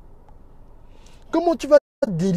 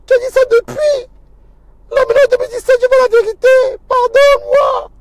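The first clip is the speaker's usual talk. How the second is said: high, loud, tearful begging; a broken caller wailing for forgiveness; pleading through tears; 13 dB louder than usual. Retold or imitated. A tearful caller begs the pastor to send him something.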